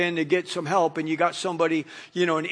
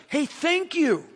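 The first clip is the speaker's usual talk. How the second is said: bright, higher grateful thank-you; 7 semitones higher, slower than usual. Retold or imitated. Shopper to the Lowe's worker, grateful. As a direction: thank the helper warmly.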